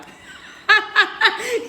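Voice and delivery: high burst of laughter; loud laugh. Real, not acted. Laughing at her own forgetful bit, loud laugh. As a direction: crack up at yourself.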